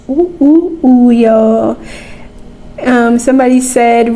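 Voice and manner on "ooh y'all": normal talking voice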